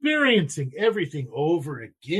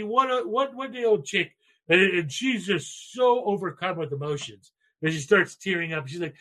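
ordinary delivery

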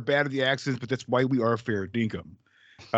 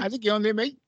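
bad Australian; 8 semitones higher than usual. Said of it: "You're so bad at it".

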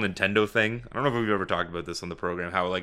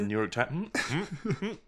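stifled laugh; 8 semitones higher, 5 dB quieter than usual. Real, not acted.

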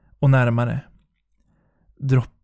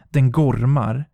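ordinary delivery

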